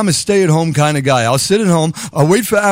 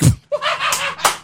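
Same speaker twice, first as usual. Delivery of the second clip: high laugh; 5 dB quieter than usual. Real, not acted. Laughing at his own joke.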